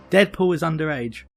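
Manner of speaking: ordinary delivery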